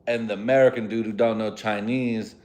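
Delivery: ordinary talk, nothing marked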